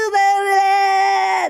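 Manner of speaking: high, loud mock-horrified "blah"; drawn-out high comic wail; exaggerated wail. Acting out hearing the smoothie's price, exaggerated wail.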